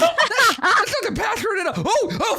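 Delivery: high, loud, hissing protest; hammed-up alarm, pitch shooting way up; alarmed spider-faced drow. Drow boss realises a fireball is coming, in high alarm. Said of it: "the spider-faced drow is like".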